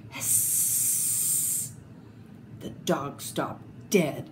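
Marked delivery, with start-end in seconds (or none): 0.1-1.7 long unvoiced snake hiss. A narrator's reading. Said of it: "a horrible hissing sound".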